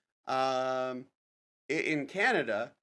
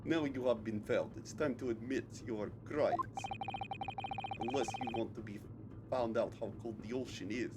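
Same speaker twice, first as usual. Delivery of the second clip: put-on softer, menacing accented villain; quiet Russian-accented threat; Russian captor; 3 semitones lower, 7 dB quieter than usual. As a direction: coldly demand a confession as a Russian interrogator. Voices the captor threatening the accused.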